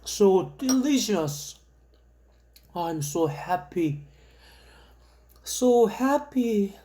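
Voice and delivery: very slow, drawn-out savoring; dreamy slow English. Pretend. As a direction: linger over every word blissfully.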